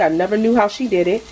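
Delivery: ordinary delivery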